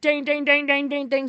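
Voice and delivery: loud, high, rapid dinging; imitated phone ring. Showing how loud her phone rang in the car, imitated ring. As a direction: mimic a blaring ringtone. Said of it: "it was the loudest ring imaginable".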